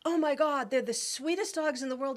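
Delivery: a dog lover lighting up with joy; excited, suddenly awake. Retold or imitated. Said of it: "her voice goes up as if she woke up".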